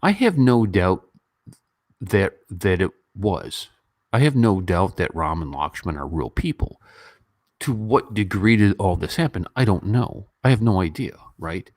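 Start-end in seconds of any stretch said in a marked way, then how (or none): none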